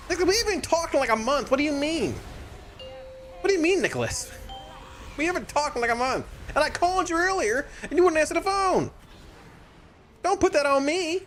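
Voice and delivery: mock jealous. Mock jealous bickering with friend.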